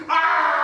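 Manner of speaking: loud pained yell. Retold or imitated. Kid in a headlock, loud pained yell. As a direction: cry out as you're grabbed. Balls clenched in a fight.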